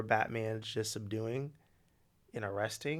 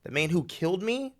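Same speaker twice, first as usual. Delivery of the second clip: a wronged vigilante lecturing Batman, voice raised; 7 semitones higher, 8 dB louder than usual. Retold or imitated.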